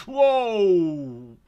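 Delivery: very loud, high drawn-out cry of shock; real explosive astonishment; shocked shout. Real, not acted. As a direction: break off into a startled yell. Reacting to sudden explosion.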